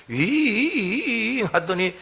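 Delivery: long, drawn-out engine hum. Retold or imitated. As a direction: hum like a jet engine spooling up, slowly. Imitating a plane engine. (느리게 길게 끄는 엔진 소리 흉내)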